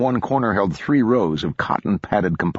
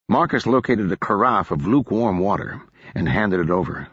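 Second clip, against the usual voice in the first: ordinary delivery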